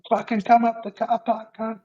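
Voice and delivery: high, put-on tough-guy taunt; retold challenge. Daring the junkie to fight in the car park, high mock taunt. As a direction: goad him like a cocky brawler.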